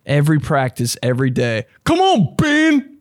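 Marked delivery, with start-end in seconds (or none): 1.8-2.9 much higher, louder mock yell at a player; coach yelling. Eighth-grade coach screaming at Ben, mock yell. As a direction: bark it like an angry coach at practice. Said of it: "basketball coach in eighth grade that would rip me to shreds".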